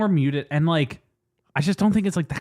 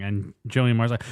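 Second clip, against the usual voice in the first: usual speaking voice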